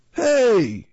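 loud, high outraged holler; shout. A narrator's reading. Big Quinn finding the tray empty, shouting. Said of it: "he shouted".

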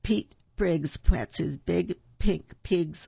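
slow, careful tongue twister. Shown as a demonstration. Host reading a tongue twister to children, slow and careful. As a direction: take each p one at a time. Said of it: "here's a quick one".

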